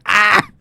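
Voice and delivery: high sharp cry of pain; pained yelp. Acted. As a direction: yelp as the arms break. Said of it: "He's like".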